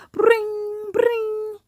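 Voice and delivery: high, slow mock alarm bell; drawn-out bring bring; alarm ring imitation. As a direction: ring it out like a clock. Alarm clock ringing imitated.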